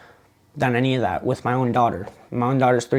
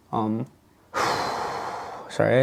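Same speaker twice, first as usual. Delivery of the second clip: emotional; 2 semitones lower, 5 dB quieter, much slower than usual. Real, not acted. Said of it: "it's a little hard to talk about this".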